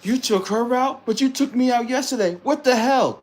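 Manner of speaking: put-on high, loud, slowed-down jealous girlfriend. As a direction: play the angry girlfriend confronting him, high and loud. Acting out a jealous Filipina.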